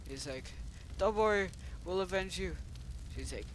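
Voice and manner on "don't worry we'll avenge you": raised, earnest toy-hero voice; play-acted heroic vow. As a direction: promise vengeance like a cartoon hero. Toy hero vows revenge.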